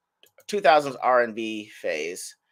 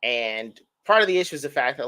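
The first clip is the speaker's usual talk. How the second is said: ordinary talk, nothing marked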